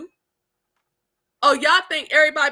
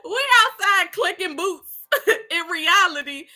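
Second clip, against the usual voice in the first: high laughing through words; cracking up at the memory; laughing hard; 4 semitones higher than usual. Real, not acted. Mother laughing at her childhood self.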